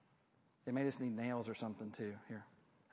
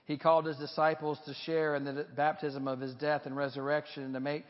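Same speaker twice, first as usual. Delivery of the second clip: solemn prayer; 2 semitones higher, 4 dB louder than usual. Acted. Continues the baptismal prayer.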